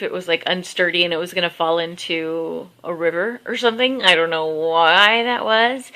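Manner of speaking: ordinary talk, nothing marked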